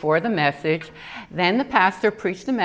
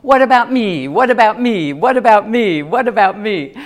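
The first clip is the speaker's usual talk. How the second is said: louder, repetitive robotic chant; 3 semitones higher, 7 dB louder than usual. Retold or imitated. Mimicking Joyce Myers's robot, louder and mechanical. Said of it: "she does this robot".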